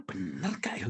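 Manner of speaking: "Bener gak ya" said low and soft, half to oneself; hushed doubt. Retold or imitated. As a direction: ask yourself quietly, unsure. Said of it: "Saya bilang".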